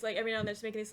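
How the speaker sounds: normal talking voice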